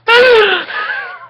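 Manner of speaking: high crying laugh; shrieking laugh. Real, not acted. Reader cracking up at the line, high shrieking laugh. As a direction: burst into helpless high laughter.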